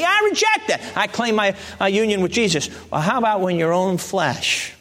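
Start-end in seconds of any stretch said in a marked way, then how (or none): 0.0-0.8 high, forceful declaration; emphatic rejection. Acted. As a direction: pitch it way up and refuse firmly. Models rejecting temptation.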